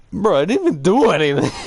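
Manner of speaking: high, fast, hammed-up protest; mock indignant protest. Pupil objects to being sent away, high mock indignation. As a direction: protest innocence in a high-pitched rush. Retelling own protest at teacher.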